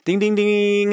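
high, ringing game-show ding; imitated bell. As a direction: sound the winning bell with your voice. Host imitates correct-answer bell.

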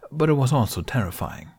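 ordinary delivery, nothing marked

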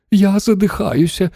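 put-on high, suffocating old woman; moaning. A narrator's reading. Grandmother running out of air, high moan. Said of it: "застогнала бабуся Джорджина".

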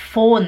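"von" begun with an F sound. Shown as a demonstration. Teacher shows learners the German V, "von" starting with F. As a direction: say "von" as if spelt "fon". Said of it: "imagina que tem um F".

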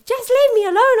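high, loud wailed plea; retold outburst. Mother outbursting at strangers, retold loud frantic wail. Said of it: "I lost it even more".

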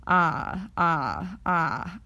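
Ah, ah, ah held slowly, each on its own; slow vowel demo. Shown as a demonstration. Teacher shows the slow take, drawn out. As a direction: draw out each ah before the glide. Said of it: "Say this one slowly and then quickly".

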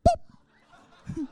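very high squeaky pop noise; cartoon boop; popping sound effect. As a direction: make the finger go pop.

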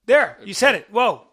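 loud happy outburst; excited. Real, not acted. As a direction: cheer it out. Reacts to name said right.

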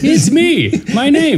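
high-pitched cartoon announcement; hammed-up squeaky self-introduction; mock Mario. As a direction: do a silly high game-character voice. Joking impression of Mario.

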